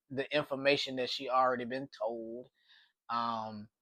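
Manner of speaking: normal talking voice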